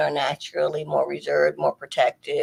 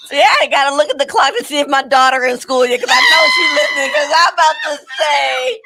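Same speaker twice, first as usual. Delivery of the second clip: excited loud; 7 semitones higher, 10 dB louder than usual. Real, not acted.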